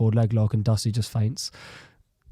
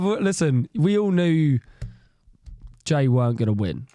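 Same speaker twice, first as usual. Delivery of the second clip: ordinary talk, nothing marked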